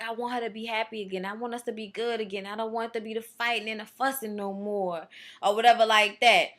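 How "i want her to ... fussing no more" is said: quiet, fast, hurt plea; soft and quick. Retold or imitated. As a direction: murmur it quickly like a sulking man. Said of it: "and he was like".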